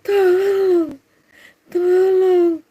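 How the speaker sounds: high, drawn-out mock wail; cries weakening. Shown as a demonstration. Preacher shows a false victim's cries easing off, drawn-out wail. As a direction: let the scream sag into a long call. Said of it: "awal-awalnya".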